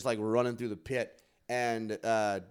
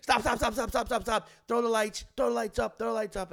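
high insistent repeated calls; imitating Zach yelling stop; commanding shouts; 10 semitones higher than usual. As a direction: halt the whole arena. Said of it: "he's just like".

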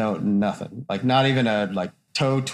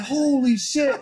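higher mock shock; 10 semitones higher, much slower than usual. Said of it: "I was like".

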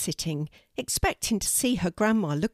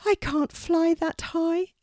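high, slow bird voice; plaintive little raven; 11 semitones higher, slower than usual. Acted. Poppy admits her limits to Kelty, high plaintive voice. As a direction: plead weakness, high and drawn out.

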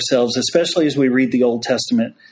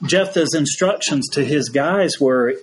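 ordinary delivery

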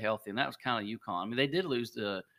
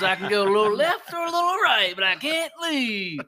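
imitated guy; 9 semitones higher, 9 dB louder than usual. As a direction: mimic him in a high whiny voice. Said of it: "He goes".

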